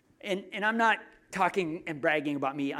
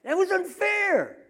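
much higher, louder, slow whining wail; drawn-out whine; 14 semitones higher, 6 dB louder, slower than usual. Retold or imitated. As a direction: stretch the whine out, indignant. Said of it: "says James's wife".

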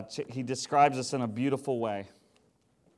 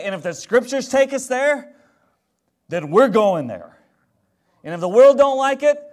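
much louder, higher preaching build; fiery preaching; 7 semitones higher, 10 dB louder than usual. Real, not acted. Pastor defying the world, loud fiery preaching. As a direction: preach it with full conviction.

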